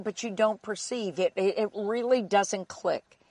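usual speaking voice

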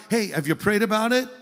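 normal talking voice

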